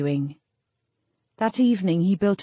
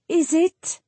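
high, tentative question; barely stammering; 10 semitones higher than usual. A narrator's reading. Jill questions the face in the fire, barely stammering. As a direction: falter it out, pitched well up. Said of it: "She could barely stammer".

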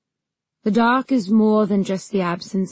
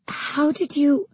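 startled; 7 semitones higher, slower than usual. Acted. Queen startled by animated bird.

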